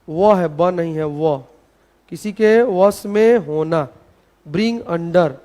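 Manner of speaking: slow, measured dictation with pauses. Shown as a demonstration. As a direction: read it out for students copying it down. (धीरे-धीरे इमला बोलने का अंदाज़)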